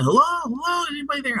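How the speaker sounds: high calling out hello; retold yell. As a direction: call out into the empty city. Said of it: "he's yelling".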